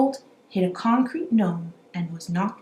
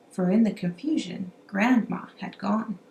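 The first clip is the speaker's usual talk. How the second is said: ordinary delivery, nothing marked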